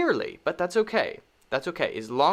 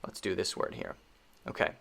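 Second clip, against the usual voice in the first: whispered aside; 7 semitones lower, 9 dB quieter, faster than usual. Real, not acted. Muttered aside to self.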